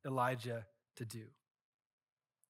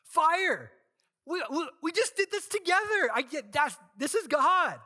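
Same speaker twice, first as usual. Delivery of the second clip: much higher and louder, almost shouting; frantic excitement; 13 semitones higher, 9 dB louder than usual. Pretend. Elijah imagined shouting at the fire, high and loud. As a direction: yell it like the fire is right there. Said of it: "he's like".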